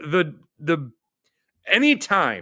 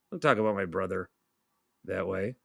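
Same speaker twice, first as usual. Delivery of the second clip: much softer, lower, fading repeat; quieter mock hurt; 7 semitones lower, 7 dB quieter than usual. Shown as a demonstration.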